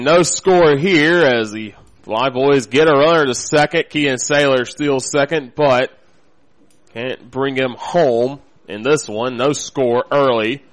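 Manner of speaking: ordinary talk, nothing marked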